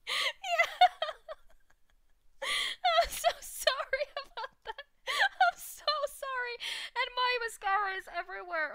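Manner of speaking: high, slow apology through real giggles; giggling helplessly while saying sorry; laughing apology. Real, not acted. Streamer apologizing to her co-host for the bot, laughing. Giggling after the meow spam.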